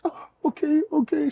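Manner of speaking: high-pitched put-on voice, giving in; flustered woman impression. Man imitating the woman told to get her things, high flustered voice.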